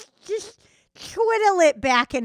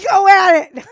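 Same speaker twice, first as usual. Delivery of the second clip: sudden high, loud yell; shout; 9 semitones higher, 16 dB louder than usual. Pretend. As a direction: burst out yelling it. Coaching, then bursting out.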